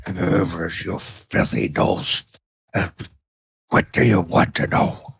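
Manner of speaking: soft, broken-speech brute; grudging captive bugbear. Acted. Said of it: "bugbear is like".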